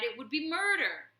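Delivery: high, loud anguished plea; abbot cries out. Acted. Abbot pleading with the warlord, high and loud. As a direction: cry out against the killing. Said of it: "he cried".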